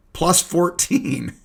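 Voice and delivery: slow, high, drawn-out disbelief; amused disbelief. Real, not acted. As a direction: stretch it out like you can't believe the number. Reading odd Twitter score graphic.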